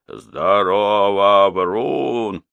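long hollered greeting, slowly stretched; drawn-out call. A narrator's reading. Old man hailing the echo in the taiga, drawn-out call. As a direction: strain the voice, calling out across the forest. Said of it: "напрягая голос, закричал".

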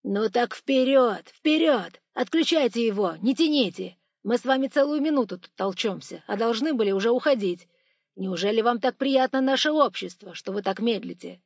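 urgent, scolding shout; impatient shouting. A narrator's reading. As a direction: berate someone for dawdling. Robber berates the slow man.

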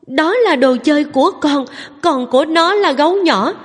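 put-on high, tearful child's protest; crying little girl. A narrator's reading. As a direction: whine it out like a wronged little girl. (giọng bé gái mếu máo, cao vút)

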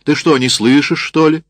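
high, loud, irritated question; impatient demand. A narrator's reading. Sergeant to a woman who will not answer, irritated shouting. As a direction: press sharply for an answer.

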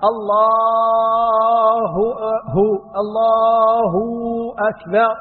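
"الله" chanted very slowly with the h drawn out into a "hu" sound; slow adhan chant with "الله" bending into "هو"; ha stretched into waw. Shown as a demonstration. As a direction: sing "الله" holding the h until it turns to "هو". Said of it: "مد الهاء".

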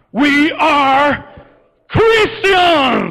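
loud, high movie war cry; grito de batalla. Pretend. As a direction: bellow it as a rallying cry, word by word. Parodies a film battle cry. (grito de batalla fuerte y pausado)

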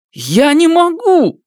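high, loud desperate cry. Acted. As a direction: shout the refusal in panic. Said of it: "повысив голос".

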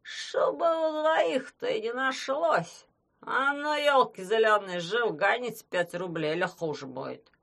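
put-on high, slow whining drunk threat; threatening drunk. A narrator's reading. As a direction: threaten like a tipsy bully.

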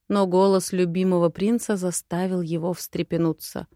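usual speaking voice

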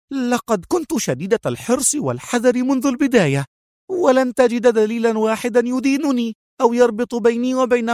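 high woman's voice, hurried and tense; high, nervous self-defense. Acted. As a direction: talk fast to cover fear. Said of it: "في عصبية".

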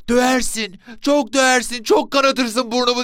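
put-on very high, loud child's cry; boy calling from inside. A narrator's reading. Son hiding in the mosque answers his father, high protesting call. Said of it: "diye seslendi içeriden".

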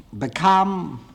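normal talking voice

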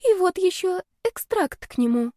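put-on high, small girlish voice; timid girl. A narrator's reading. Girl hands roommate the potion extract, timid and high. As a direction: offer it timidly, pitched high.

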